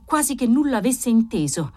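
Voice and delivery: ordinary delivery, nothing marked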